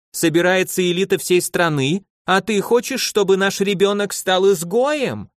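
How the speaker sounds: a wife's formidable rising anger. A narrator's reading. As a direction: raise your voice to scold your husband. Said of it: "подняла грозный голос женщина".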